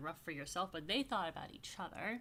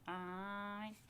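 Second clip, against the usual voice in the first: soft amen; 7 dB quieter than usual. Real, not acted. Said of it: "You can say".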